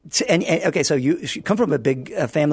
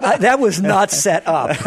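laughing; 8 semitones higher, 3 dB louder than usual. Real, not acted. Host laughing at joke.

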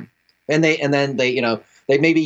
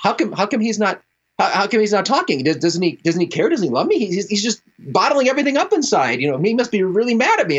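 higher-pitched, fretful questioning; lightly acted exasperation, pitched up; mock upset wife; 7 semitones higher than usual. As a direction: imitate a wife worrying at his silence.

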